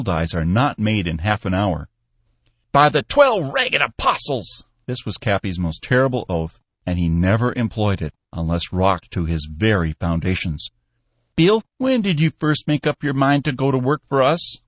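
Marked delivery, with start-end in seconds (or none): 2.7-4.6 put-on high, loud startled old man oath; an old man rocked to his foundations; shocked old Cappy. A narrator's reading. Cappy swearing at Bill's business card, high startled old man. 11.3-14.6 put-on a touch higher, wondering old man; an old boss caught off guard; old Cappy. A narrator's reading. Cappy questioning Bill about the job, lightly voiced old man.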